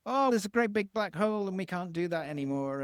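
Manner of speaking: hammed-up politician making excuses; mock politician in heart voice. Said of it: "they go into heart and go".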